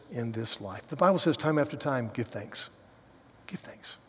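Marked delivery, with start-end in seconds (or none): 3.5-4.0 whispered. Shown as a demonstration. Repeats scripture then whispers it.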